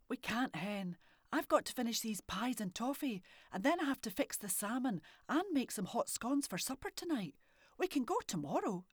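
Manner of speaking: a lightly voiced busy Scottish mum. A narrator's reading. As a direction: turn her down kindly but firmly.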